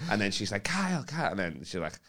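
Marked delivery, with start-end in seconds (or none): 0.6-1.3 high, soft voice of a woman intervening; imitated woman's plea. Woman to comic mid-confrontation, high soft imitated plea. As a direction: lift the name high and keep it soft.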